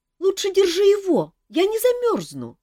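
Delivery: high quick chick protest; urgent squeak. A narrator's reading. Chick refuses to leave Kantor, high squeak. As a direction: peep out a protest.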